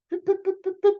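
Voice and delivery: very high, quick pip pip; ping pong pips. Acted. Storyteller voices the ping pong ball, very high. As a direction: tap out each ball hit.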